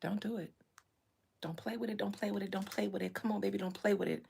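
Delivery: soft, low menacing warning; quiet warning. Acted. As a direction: warn them softly.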